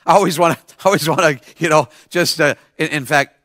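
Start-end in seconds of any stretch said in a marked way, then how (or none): none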